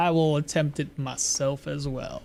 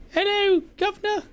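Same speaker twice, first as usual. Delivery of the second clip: much higher squeaky British lady; horrible falsetto cockney. Shown as a demonstration. Gamer failing a female cockney voice, high falsetto. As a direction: chirp it like a cockney woman. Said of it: "No, that's, that's horrible".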